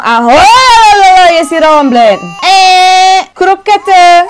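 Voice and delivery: high, loud put-on crying baby voice; hammed-up baby cry; mock baby crying. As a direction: wail like a whiny baby.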